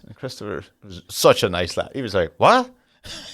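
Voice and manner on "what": high, loud startled "what?"; startled pupil. Retold or imitated. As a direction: voice a baffled pupil caught off guard. Voicing the accused boy's startled reply.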